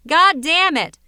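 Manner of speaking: frustrated yell at a crashing website; angry outburst. Acted. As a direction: blow up at the computer. Email writer swears at AOL.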